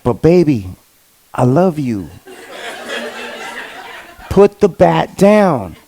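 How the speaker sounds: low, soft, pleading; a hint of Henry Hill talking his wife down; pleading Goodfellas husband. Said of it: "I played that scene verbatim".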